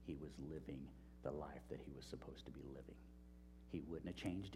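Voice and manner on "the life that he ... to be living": soft unvoiced whisper for emphasis; preacher whispering the key line to the congregation; hushed whisper. Real, not acted. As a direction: drop to a whisper to drive it home. Preacher underlines the point.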